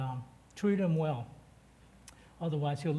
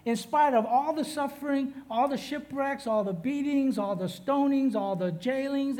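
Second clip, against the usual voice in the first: higher, louder impassioned apostle; Paul speaking from prison; 5 semitones higher, 3 dB louder than usual. Acted. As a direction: plead like Paul about to die. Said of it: "and he says".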